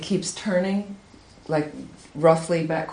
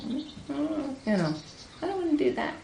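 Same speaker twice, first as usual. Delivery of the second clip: much higher, softer reluctant whine; whiny ego; 9 semitones higher, 7 dB quieter than usual. Retold or imitated. Ego answering the teacher's suggestions, whining. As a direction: refuse like a sulky child. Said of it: "the unhealthy parts of my ego go".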